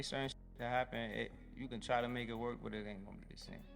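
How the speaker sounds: ordinary delivery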